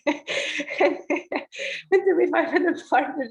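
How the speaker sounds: high, laughing through the words. Real, not acted. Mother laughing while telling the podcast host about her birth. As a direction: laugh as you recall it.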